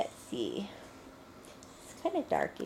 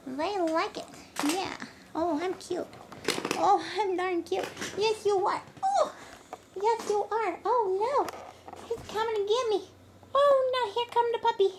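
high, sing-song puppy talk; talking to a dog; 9 semitones higher, 6 dB louder than usual. Real, not acted.

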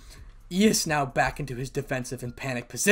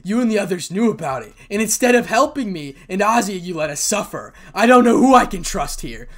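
loud, higher furious rant; really angry; 6 semitones higher, 9 dB louder than usual. Acted. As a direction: hurl accusations at those who betrayed you. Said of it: "Issei now getting really angry".

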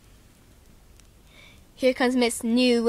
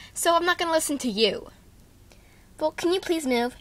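normal talking voice

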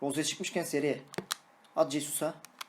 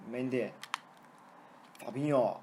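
ordinary delivery

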